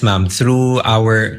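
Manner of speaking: usual speaking voice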